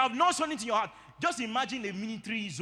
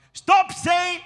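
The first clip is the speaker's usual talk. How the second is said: high, loud stop-command to the congregation; sharp rebuke; 9 semitones higher, 9 dB louder than usual. Real, not acted. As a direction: cut them off hard, higher and louder. Preacher rebukes wrong confession.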